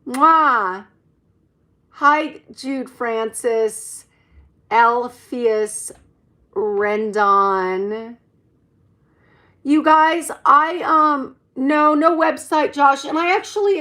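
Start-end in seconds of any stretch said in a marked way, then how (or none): 0.1-0.9 loud blown kiss; kiss sound. Real, not acted. Blowing kiss to viewers. 1.9-13.0 calling out names loudly; cheerful loud shout-outs; loud greetings. Real, not acted. Greeting chat viewers loudly.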